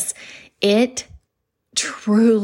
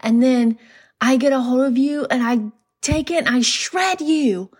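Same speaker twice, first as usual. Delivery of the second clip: Chris Farley impression; 6 semitones higher than usual. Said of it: "He's like".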